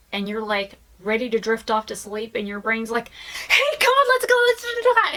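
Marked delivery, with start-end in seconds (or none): 3.5-5.1 very high, loud excited squeal; hyperactive brain. Acted. Brain jolting someone drifting off to sleep, hyper. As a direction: burst in as an overexcited brain, way up high.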